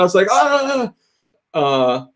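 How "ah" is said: high, drawn-out "ah"; mock yelp under running water; hammed-up wail; acted cry of pain. Retold reaction to water on cut.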